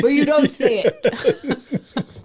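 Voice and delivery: laughing through a slow tease; slow, giggling tease. Real, not acted. Teasing, laughing at husband.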